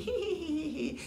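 high, soft sneaky tittering; villainous little "hee hee" giggle; gleeful giggle. A narrator's reading. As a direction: titter like a scheming fox. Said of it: "Fox giggled with glee".

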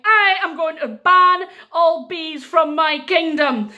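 slow, high, loud royal decree; defiant proclamation. Acted. King banning all bees, defiant decree. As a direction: proclaim it loudly, word by word.

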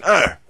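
short mock scream of pain; sudden loud pained "Äh!"; retold yell. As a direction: yelp it like the game character. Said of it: "da hat er gleich wieder geschrien".

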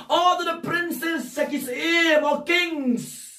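high, mounting list to the congregation; full-force preaching. Real, not acted. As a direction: hammer out each group fervently.

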